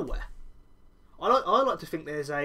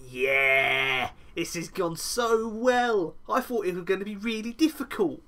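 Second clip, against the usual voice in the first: high, gloating Cole voice, slow at first; gloating high mock Cole; smug Cheshire cat; 7 semitones higher than usual. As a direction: grin through it like a Cheshire cat. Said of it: "he is like a Cheshire cat".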